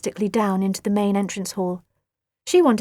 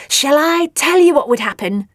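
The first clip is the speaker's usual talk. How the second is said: gleeful cackle, higher and louder; cackling; 5 semitones higher, 6 dB louder than usual. A narrator's reading. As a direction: cackle it out with relish. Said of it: "cackled Nelly".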